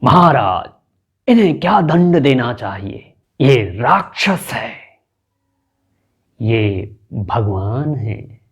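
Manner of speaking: calls out to the king, then slows right down; theatrical plea to the king; dramatic courtier. Shown as a demonstration. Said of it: "एक और देखिए".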